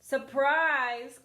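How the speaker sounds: spring it on her loudly; wolf cries surprise. Acted. Wolf bursts in on Granny, crying out loud. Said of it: "cried the wolf".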